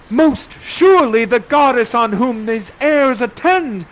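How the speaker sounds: loud, high, slow proclamation; crying out in awe. Acted. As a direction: declaim it, dazzled by her. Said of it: "you cry out".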